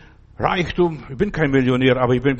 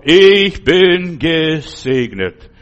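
slow, loud proclaiming cry of Ich bin gesegnet; calling out loud; 8 dB louder, much slower than usual. Shown as a demonstration. Preacher showing how he cries out in dark hours, slow loud call. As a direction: shout it out slowly like a proclamation. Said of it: "rufe ich ganz laut".

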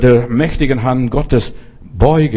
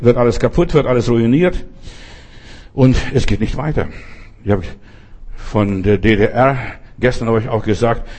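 ordinary talk, nothing marked

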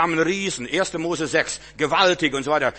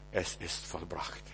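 dying last words; 8 semitones lower, 12 dB quieter, slower than usual. Acted. Quotes Jesus dying.

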